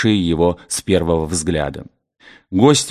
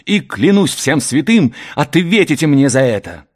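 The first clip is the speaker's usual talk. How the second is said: raised-pitch threatening vow; heated, higher-voiced threat; angry exclamation; 5 semitones higher than usual. A narrator's reading. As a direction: swear vengeance, voice pitched up. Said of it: "воскликнул он, бросаясь на победителя".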